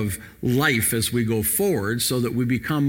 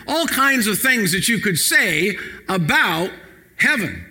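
impassioned preaching; 5 semitones higher than usual. Acted. Pastor urging the church to pray this verse, impassioned. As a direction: stretch every phrase to stir the room.

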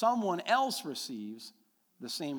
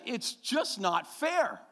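slow, high, louder lament; mom complaining; 9 semitones higher, 6 dB louder, much slower than usual. Retold or imitated. Son quotes his mother's grievance, slow and high. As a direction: drag it out like an aggrieved mother. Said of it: "she said".

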